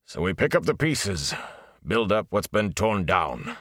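a lightly voiced tired village smith, slow; weary blacksmith. Blacksmith consoling Rand after the raid, lightly voiced and weary.

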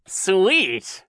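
high sneering echo, smiling; horrible smile. A narrator's reading. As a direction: sneer it through a nasty grin. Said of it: "repeated with a horrible smile".

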